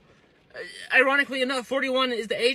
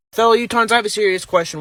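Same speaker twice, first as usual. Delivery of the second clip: ordinary talk, nothing marked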